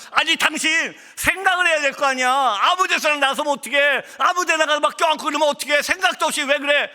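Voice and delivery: high-pitched re-enacted husband's tirade; angry scolding. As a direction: act out telling off a wife. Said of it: "제가 막 화를 냈습니다".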